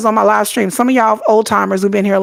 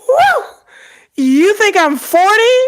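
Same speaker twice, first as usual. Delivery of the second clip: high, slow, thrilled whew; delighted disbelief; 11 semitones higher, 3 dB louder, slower than usual. Retold or imitated. To the commenter, high and delighted. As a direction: savor the flattering insult. Delighted at being guessed older.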